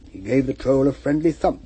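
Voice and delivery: ordinary delivery, nothing marked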